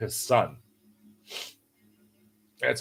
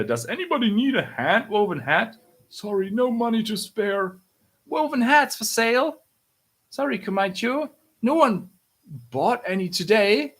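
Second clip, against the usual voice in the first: high-pitched little street-seller voice; hopeful hat seller; 8 semitones higher than usual. Acted. Child selling woven hats to passers-by, high hopeful voice.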